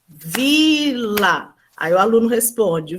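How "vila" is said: "Vila" split slowly into vi and la; syllable by syllable. Shown as a demonstration. Teacher claps out syllables with pupils, "Vila" in two drawn-out beats. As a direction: say "Vila" one beat per syllable. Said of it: "bater uma palma por cada sílaba".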